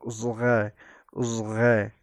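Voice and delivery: "ازغه" with a heavy z, whole tongue on the palate; emphatic Z. Shown as a demonstration. Teacher demonstrating the Arabic letter, heavy "ازغه". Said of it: "زبان پوره در کام بالا قرار".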